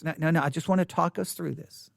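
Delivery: ordinary talk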